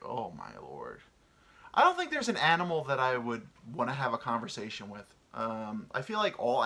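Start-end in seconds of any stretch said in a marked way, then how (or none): 0.0-1.0 weary sigh. Acted. Reaction to wired animal.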